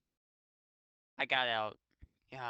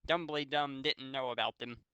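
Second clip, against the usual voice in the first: ordinary delivery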